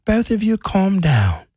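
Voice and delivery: slow mock English-accented scolding; Bailey Coleman, English accent. Host imitating Bailey telling both to calm down, English accent. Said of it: "She got an English accent".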